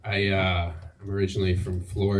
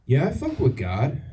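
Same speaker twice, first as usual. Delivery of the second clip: higher, mock fresh-out-of-rehab voice; drug addict impression; 6 semitones higher than usual. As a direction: play a recovering addict, lightly. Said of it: "my impression of a drug addict that just got out of rehab".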